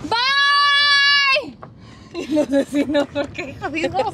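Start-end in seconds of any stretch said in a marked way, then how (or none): 0.1-1.6 loud, high yelled goodbye; big shouted bye. Real, not acted. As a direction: belt it out to the street. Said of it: "voy a pegar un gritote". (gritote de despedida a los vecinos)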